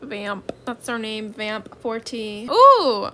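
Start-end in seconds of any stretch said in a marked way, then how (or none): 2.5-3.1 loud, high delighted ooh; excited ooh. Real, not acted. Streamer spots rare pets, loud excited ooh. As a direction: gasp with delight at the offer. Sees good trade pets.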